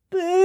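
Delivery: hammed-up troll crying; mock sobbing. A narrator's reading. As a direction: cry it out like a sad troll. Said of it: "And they did cry".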